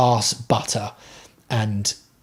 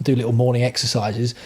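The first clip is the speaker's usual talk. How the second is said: ordinary delivery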